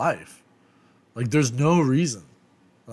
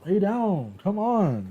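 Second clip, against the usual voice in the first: pleading with dogs; 8 semitones higher, slower than usual. Real, not acted. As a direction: beg the dog to finally settle. Coaxing the dogs.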